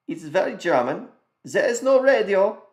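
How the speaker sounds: hammed-up German accent, high and loud. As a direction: play a stiff German salesman. Mock German speaking about car.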